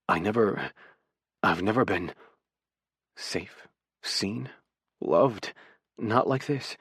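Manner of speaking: halting, hushed confession with long pauses; struggling to speak. A narrator's reading. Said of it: "the words he struggles to say".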